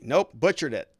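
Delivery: ordinary talk, nothing marked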